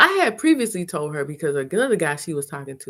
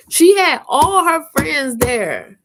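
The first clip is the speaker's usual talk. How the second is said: surprised; 8 semitones higher, 7 dB louder, much slower than usual. Real, not acted. Surprise at friends there.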